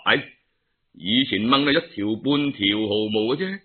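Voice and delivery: ordinary delivery, nothing marked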